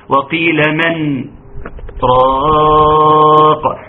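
long-drawn "راق" after a brief stop on "من"; measured Quranic recitation with a pause before "راق"; saktah recitation. Shown as a demonstration. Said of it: "دول أربع سكتات واجبة".

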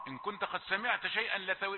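ordinary talk, nothing marked

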